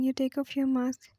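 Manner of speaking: ordinary delivery, nothing marked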